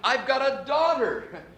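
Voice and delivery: high, breathless delight at the news; new father hearing it's a girl, thrilled and high; overjoyed. Acted. Father learns he has a daughter.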